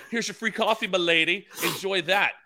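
high, courtly serving voice; gallant waiter voice. Retold or imitated. Husband serving wife free coffee, high courtly voice. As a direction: hand it over like a gallant butler. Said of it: "I'll do different voices at the window".

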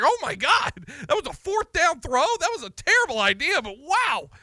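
replaying his own shock at the fourth-down throw, high and loud; astonished disbelief. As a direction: act out jaw-dropping amazement at a gamble that paid off. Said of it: "You're just like".

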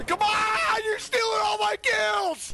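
high, strained yell; gamer rage scream. Pretend. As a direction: shriek like a raging gamer.

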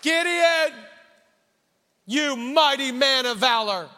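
high, loud angelic call; proclaiming angel. Acted. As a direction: call him out grandly. Angel's call a third time.

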